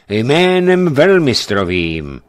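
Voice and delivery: loud, slow solemn proclamation; a priest suddenly commanding the knights, ringing and slow; changed voice. Acted. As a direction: call it out in the grand master's name. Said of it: "zvolal změněným hlasem".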